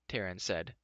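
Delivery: plain reading voice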